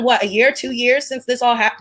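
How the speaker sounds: ordinary delivery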